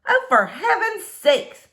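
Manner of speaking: slow astonished neighbor cry; exasperated neighbor. Acted. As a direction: drag it out in disbelief. Missus Tickham sees the squirrel.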